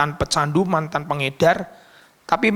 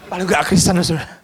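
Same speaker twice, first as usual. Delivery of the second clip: ordinary delivery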